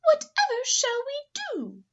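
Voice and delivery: high, loud worried lady voice; fretful poodle mother. Acted. Missus Poodle asking Missus Bulldog, high worried voice. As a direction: fret over the mix-up. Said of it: "Missus Poodle".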